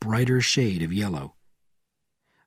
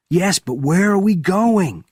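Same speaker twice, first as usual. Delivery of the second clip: high, loud question; shouted; 9 semitones higher, 6 dB louder than usual. A narrator's reading. Benjamin shouting to Bourne in the jeep, high and loud. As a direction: shout it over the noise. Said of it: "Benjamin shouted".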